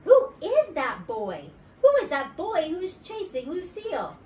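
loud, high yelled question; yelling. A narrator's reading. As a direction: yell it across the playground in alarm. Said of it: "she yelled".

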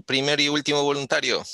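usual speaking voice

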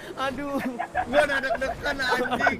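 high, loud, laughing exclamation; laughing relief. Real, not acted. Player admitting nerves to friends after the prank, laughing. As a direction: blurt it out loudly while cracking up.

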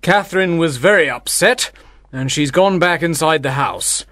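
mildly angry father explaining; understated acted anger. A narrator's reading. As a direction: reproach him sternly. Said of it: "Baptista explained angrily".

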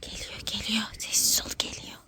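hushed urgent whisper; whispering while hiding. Pretend. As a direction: warn softly that someone's coming. Hiding from the seeker.

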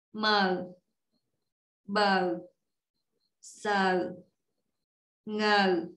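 slow syllables with long pauses between; measured, spaced-out letter drill; slow recitation. Shown as a demonstration. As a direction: read each letter slowly, one at a time. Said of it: "Đọc chậm lại một lần nha".

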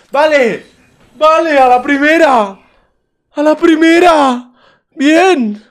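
very loud, very high yelling of joy; triumphant shout. Real, not acted. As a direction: scream in triumph, stretching the words out. Hits the roll first try. (grito de euforia)

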